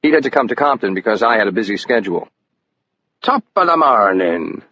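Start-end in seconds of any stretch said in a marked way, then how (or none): 3.2-4.6 slow, hammed-up Irish brogue greeting; exaggerated Irish accent. A narrator's reading. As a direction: lay the Irish accent on thick, stage-Irish. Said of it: "Levin said in an exaggerated Irish accent".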